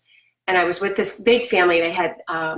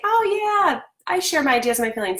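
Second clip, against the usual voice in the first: breezy "Oh yeah" jumping way higher, then a quick, offhand "I share my ideas and my feelings"; glib adult agreeing; 8 semitones higher than usual. Retold or imitated. Mom mimicking every adult's easy agreement, breezy and fast. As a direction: toss it off like it's obvious. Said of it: "Every adult would say".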